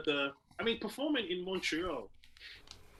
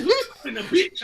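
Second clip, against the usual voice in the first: offended women; 10 dB louder than usual. Retold or imitated. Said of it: "They said".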